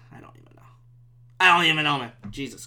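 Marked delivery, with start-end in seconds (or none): 0.1-0.6 barely audible whisper to himself; defeated whisper. Real, not acted. Podcaster to himself after losing the argument, defeated whisper.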